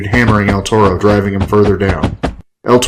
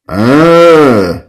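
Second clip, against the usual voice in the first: zombie grunt; 4 semitones higher, 5 dB louder than usual. A narrator's reading. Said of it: "Rio said".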